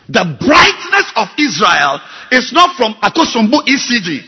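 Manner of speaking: loud, climbing pulpit rebuke; preaching at full force. Acted. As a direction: hammer the congregation with each question. Pastor preaches on Isaiah.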